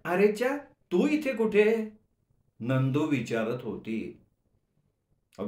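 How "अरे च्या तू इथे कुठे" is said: put-on higher, surprised young woman's call; a girl caught off guard by a friend. A narrator's reading. Girlfriend spotting him on the train, surprised. Nandu surprised to see narrator.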